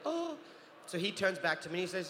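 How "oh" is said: high swooning oh; fangirling gasp. Retold or imitated. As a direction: swoon like a starstruck fan. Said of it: "She's fangirling out, like".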